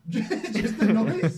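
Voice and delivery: laughing hard, high and loud. Real, not acted. Host cracking up at co-host's silly noise, laughing. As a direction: crack up while asking.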